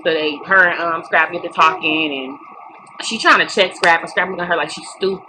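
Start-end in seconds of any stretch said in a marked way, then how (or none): none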